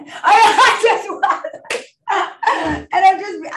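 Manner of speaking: laughing through the words, high and loud. Real, not acted. Recovering addict laughing about her wild past. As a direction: break into real laughter mid-confession.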